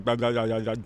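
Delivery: soft mumbled mock-Cockney babble; Cockney gibberish. Shown as a demonstration. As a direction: babble like a Cockney without using the tongue. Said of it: "they kinda talk like".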